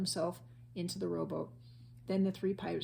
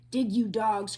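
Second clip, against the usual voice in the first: louder pirate command; cried out; 4 semitones higher, 10 dB louder, slower than usual. A narrator's reading. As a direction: yell it at the crew. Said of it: "cried Captain Bones".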